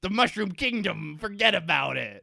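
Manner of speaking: tough-guy Brooklyn Mario; Brooklyn accent. Retold or imitated. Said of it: "a gruff Brooklyn accent".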